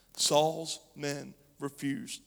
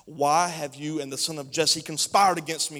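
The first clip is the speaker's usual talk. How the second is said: louder accusing question; angry demand; 10 dB louder than usual. Acted. Saul demands answers from the priest, angry. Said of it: "Saul demanded".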